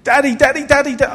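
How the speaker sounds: high, loud cry of Daddy; mock scream. Preacher retelling his daughter's fall, high loud mock cry. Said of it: "it was a blood-curdling scream".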